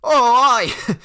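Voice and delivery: high, loud gleeful aye; mocking laugh; laughing bully. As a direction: cackle along. Craig laughing.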